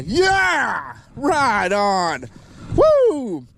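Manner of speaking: high happy shout; excited cheer. Real, not acted. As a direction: cheer the fish in. Landing another fish.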